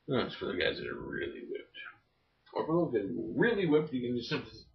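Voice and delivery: ordinary talk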